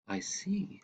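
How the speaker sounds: a breathy whispered aside. Acted. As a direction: hush it right down to a whisper. Boy hushed by grandfather's story.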